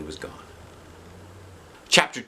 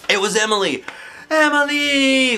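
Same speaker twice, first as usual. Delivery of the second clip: screaming; 5 dB louder than usual. Acted. The class screaming when Emily walks in. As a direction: yell it out in relief. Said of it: "we all screamed".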